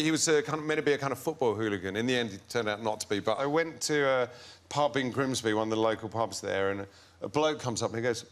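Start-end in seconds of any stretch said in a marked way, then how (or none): none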